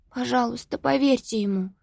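lightly voiced begging girl; pleading. A narrator's reading. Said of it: "просила Софи".

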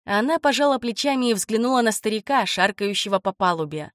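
ordinary delivery

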